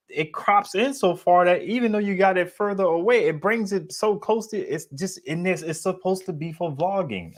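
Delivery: high-pitched, worked-up complaint; exasperated rant. Real, not acted. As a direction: pitch climbs in disbelief at the absurdity. Exasperated rant about a useless camera.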